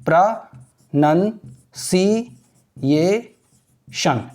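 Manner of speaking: प्रोनाउंसिएशन very slowly as "pruh... nun... see... ay... shun"; syllable by syllable. Shown as a demonstration. Teacher models प्रोनाउंसिएशन for students, drawn out syllable by syllable. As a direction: break प्रोनाउंसिएशन into separate syllables with long pauses, "nun" not "noun". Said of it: "ऐसे, ठीक है ना?".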